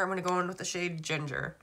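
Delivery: ordinary delivery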